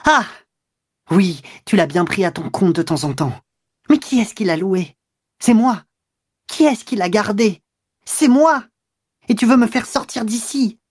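high-pitched indignant questions and answers; furious outburst. A narrator's reading. Woman who rented the place, furiously reproaching him. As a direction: hammer out who paid, pitch leaping. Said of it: "elle éclata".

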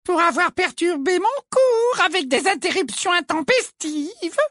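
very high, syrupy and prim; a wicked stepmother's sweetness; sugary voice. A narrator's reading. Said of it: "de sa voix doucereuse". (voix doucereuse)

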